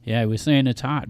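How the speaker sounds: ordinary delivery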